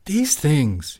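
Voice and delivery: loud, high burst of disgust; spat. A narrator's reading. Father to wife about the carvings, spitting angrily. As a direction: spit both words out. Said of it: "he spat again".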